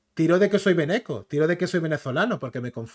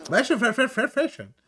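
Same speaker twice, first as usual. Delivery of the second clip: high American gibberish imitation; exaggerated American r sounds; mock American accent; 6 semitones higher than usual. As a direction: parody American speech as repeated run-together syllables. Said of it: "Así hablan los, los americanos".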